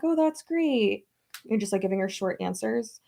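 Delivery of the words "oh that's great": higher, drawn-out polite reply; politely feigned interest. Retold or imitated. Giving a chatty stranger short answers, high polite feigned interest. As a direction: stretch it out high, just being nice.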